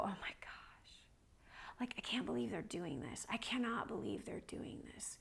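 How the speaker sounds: hushed, voiceless disbelief; stunned whisper. Retold or imitated. Retold awed reaction to suffering staff.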